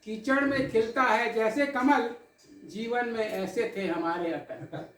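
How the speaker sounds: measured, dramatic poetic recital with held pauses; poem recitation. Acted. Poet recites tribute to the audience, in recital cadence. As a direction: recite it like a poet at a memorial gathering.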